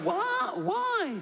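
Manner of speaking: high, squeaky shock; comic falsetto outrage; shocked squeak. Retold or imitated. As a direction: jump up into a falsetto squeal of disbelief. Doctor shocked in acted scene.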